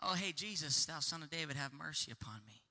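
lazy quiet mock request from a beggar who won't bother; casual mumbled plea. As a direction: toss off the plea half-heartedly under your breath. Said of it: "he didn't just go".

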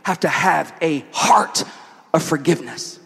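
emphatic, projected sermon delivery; preaching. Real, not acted. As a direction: preach it to the room, driving each point home. Preaches at full force.

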